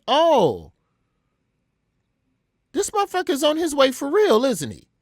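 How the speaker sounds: high, loud, panicky yelp; alarmed realization. Retold or imitated. Prank caller learning the rapper is on his way, alarm. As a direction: shriek it as it dawns on you.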